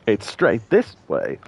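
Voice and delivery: slow, each word drawn out; slow emphatic. Shown as a demonstration. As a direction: spell it out slowly. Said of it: "I'm straight as an arrow".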